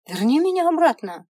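high, loud plea with a fall on меня; desperate plea. Acted. Girl begging the imp, loudly desperate. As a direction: cry out верни меня обратно hard.

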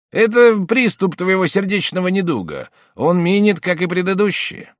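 high, loud brisk reassurance; uncle voice. A narrator's reading. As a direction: cheer up the sick friend.

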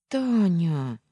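loud, high, drawn-out name; stunned drawl. A narrator's reading. Heroine taken aback by friend's accusation, stunned drawl. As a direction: stretch the name out in shock. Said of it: "опешив, протянула я".

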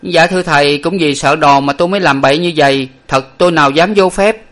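mildly frightened servant, high voice; high, apologetic pleading; fearful. A narrator's reading. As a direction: make a nervous excuse to the master. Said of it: "có ý sợ nên nói".

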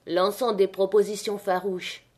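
usual speaking voice

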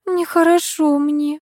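slow frail murmur; weak faint voice. Acted. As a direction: sound faint and unwell. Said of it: "прошептала Николаева".

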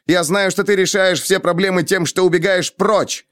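high-pitched reproachful climax; heated accusation. A narrator's reading. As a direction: drive the charge home angrily. Accusations build in a quarrel. (зло, с напором обвиняя)

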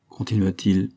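ordinary delivery

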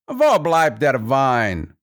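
merry count's slow, high call; jovial count calling. A narrator's reading. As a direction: call lazily for the wine, stretching the words. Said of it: "und begann zu lachen".

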